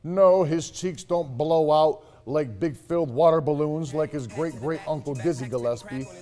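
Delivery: ordinary delivery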